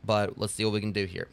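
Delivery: ordinary delivery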